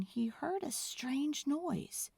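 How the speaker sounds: slow breathy whisper; hushed. A narrator's reading. Narrator whispering to build suspense. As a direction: drop to a whisper for the mystery.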